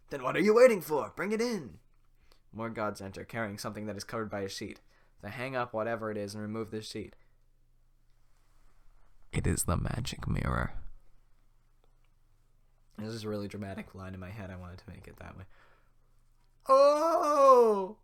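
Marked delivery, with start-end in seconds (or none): 0.1-1.8 impatient lord. Acted. Farquaad impatient order. 16.7-18.0 high, loud "Oh" of wonder; awed gasp. Acted. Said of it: "That was supposed to be in awe".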